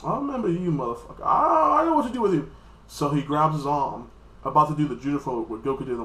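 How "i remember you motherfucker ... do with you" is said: high mock outburst of recognition; hammed-up anime fighter threat; Vegeta. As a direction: act it out pitched up, swelling on the last threat. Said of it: "Vegeta's like".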